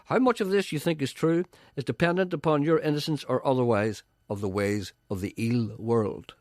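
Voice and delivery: ordinary talk, nothing marked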